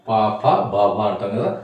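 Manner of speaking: పాప and బాబా said slowly and clearly, syllable by syllable; slow example words. Shown as a demonstration. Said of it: "రెండిటికి ఇదే".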